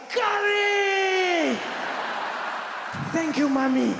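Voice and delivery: high, drawn-out joyful yell; overjoyed, full-volume cry; ecstatic scream. Acted. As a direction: scream the word long, then give loud thanks. Acted delighted first taste of curry.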